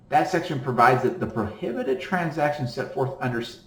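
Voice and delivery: ordinary talk, nothing marked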